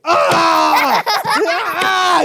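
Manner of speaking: loud high hammed-up cry; mock yell. Uncle acting Pharaoh falling, mock yell. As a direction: shout as you tumble over.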